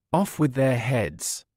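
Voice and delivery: ordinary delivery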